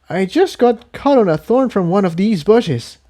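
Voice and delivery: high, light pony voice brushing off the pain; small pony insisting she is fine; Tiptoe's high voice. A narrator's reading. Voices Tiptoe after thorn.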